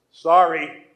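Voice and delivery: a loud, grudging "Sorry." thrown out without meaning it. Retold or imitated. Boy forced to apologize to his sisters, grudging. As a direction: snap "Sorry." just to get it over with. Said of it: "was I really being sorry?".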